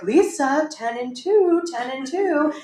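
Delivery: high-pitched anxious mom reciting rules; nervous mother. Retold or imitated. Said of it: "my mom is very nervous".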